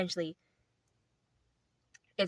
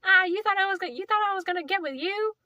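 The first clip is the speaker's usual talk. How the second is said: put-on much higher, louder, sneering girl voice; high, mocking brush-off; mean girl rejection; 13 semitones higher, 6 dB louder than usual. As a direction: taunt him in a shrill-pitched mean-girl voice. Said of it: "and she's like".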